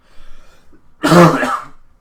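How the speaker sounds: loud harsh cough; real cough. Real, not acted. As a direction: cough hard into the mic. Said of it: "because I need a cough".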